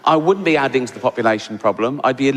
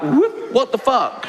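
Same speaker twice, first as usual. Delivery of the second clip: mock shock; 7 semitones higher, slower than usual. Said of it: "She'd come back".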